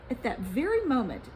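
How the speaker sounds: ordinary delivery, nothing marked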